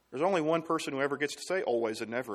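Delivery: ordinary delivery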